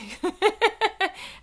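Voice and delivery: real burst of high, uneasy giggling; uncomfortable giggle. Real, not acted. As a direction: giggle awkwardly at your own admission. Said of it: "I giggle when I'm uncomfortable".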